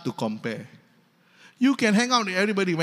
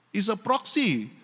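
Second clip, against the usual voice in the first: ordinary talk, nothing marked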